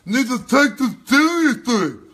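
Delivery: high loud sneering mimicry; mocking parrot. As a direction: drag it out mocking the guest. Mocks the guest's line.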